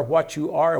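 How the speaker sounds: usual speaking voice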